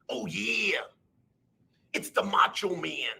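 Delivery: a quick gruff oh yeah; Macho Man impression. Streamer tossing off Macho Man for chat, gruff. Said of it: "I can do Randy Macho Man Savage".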